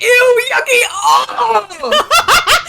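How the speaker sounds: high, loud disgusted shriek with a laugh; grossed-out squeal. Acted. Blair reacting to the naked baby rats, squealing in disgust. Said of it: "She's like".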